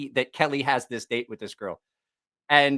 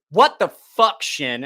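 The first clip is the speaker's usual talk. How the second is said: angry yell; 3 semitones higher, 7 dB louder, much slower than usual. Retold or imitated. Angry Kelly confronting Shin at the bar, loud furious yell. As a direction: storm in and demand answers.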